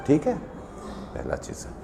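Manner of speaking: slow whispered aside to himself; whispering a quick self check. Real, not acted. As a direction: drop to a breathy whisper, almost to himself. Aside to himself mid-lesson.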